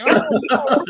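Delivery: high, laughing mock cousin. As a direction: cackle the no out, way up high. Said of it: "She was like".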